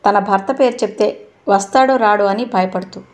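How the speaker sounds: ordinary delivery, nothing marked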